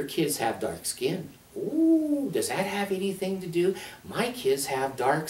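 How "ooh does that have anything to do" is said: much higher sarcastic "Ooh"; mock surprise. Real, not acted. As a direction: feign shock, sarcastic.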